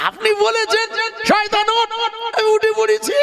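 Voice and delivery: high, sly mocking answer; cheeky high reply. Satan teasing Noah about his words, high and cheeky. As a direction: throw the words back at him, sly and high.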